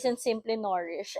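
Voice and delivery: ordinary delivery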